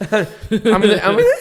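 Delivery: squeaky, cracking lost voice; squeaky broken voice. Real, not acted. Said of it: "I'm gonna sound like a broken squeaky toy".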